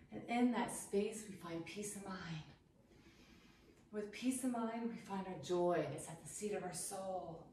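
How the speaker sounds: soft, hushed meditation voice, drawn inward; guided meditation. Acted. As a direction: guide them into stillness softly. Meditative closing reflection.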